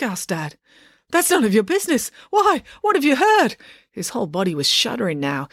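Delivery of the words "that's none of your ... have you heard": high, nervous rush of words; rapid-fire panic. A narrator's reading. Dad panics at the doctor, high nervous rush. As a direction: gabble it out in a panic. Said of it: "talking at such a rapid-fire pace".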